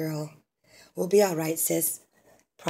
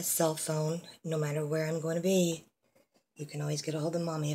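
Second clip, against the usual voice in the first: ordinary delivery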